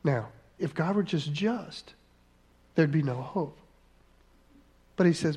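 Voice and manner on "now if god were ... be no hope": ordinary delivery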